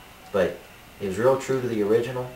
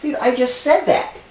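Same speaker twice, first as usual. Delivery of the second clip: high, loud indignant yelling; raging gamer; 8 dB louder than usual. Acted. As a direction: rant about being ripped off. Angry accusation of copying.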